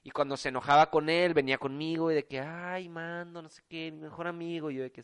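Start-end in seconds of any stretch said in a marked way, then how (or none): 2.4-4.7 mock whiny girl. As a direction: lightly whine like the girl friend. Imitating girl's whiny complaint.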